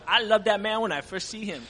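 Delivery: a Creole grandmother gushing over the pastor, loud and high; excited old woman. Retold or imitated. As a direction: boom out her delight the way she said it. Retelling the old woman's delight.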